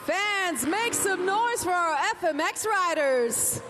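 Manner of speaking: fast, rising crowd rally; rally speech. Real, not acted. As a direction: whip the crowd into noise.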